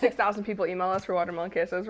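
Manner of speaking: normal talking voice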